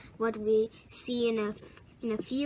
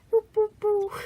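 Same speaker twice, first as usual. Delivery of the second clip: whispered boops; 9 semitones higher, 4 dB louder than usual. Pretend. Playing with toy in water.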